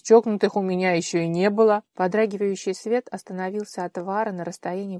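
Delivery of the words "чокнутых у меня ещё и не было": grumbling man. Acted. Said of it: "недовольно проворчал мужской голос".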